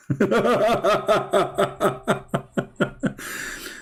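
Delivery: high squeaky mocking laugh; squeaky taunting giggle; mock devil laugh. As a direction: cackle like a cartoon devil. Acting shaitan's laugh.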